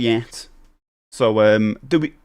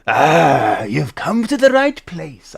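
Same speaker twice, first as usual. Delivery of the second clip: accidental Welsh lilt; 3 dB louder, much slower than usual. Acted. Streamer greets customer as a shopkeeper, slipping into Welsh. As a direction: draw it out with a singsong Welsh lilt. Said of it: "I don't want to go Welsh".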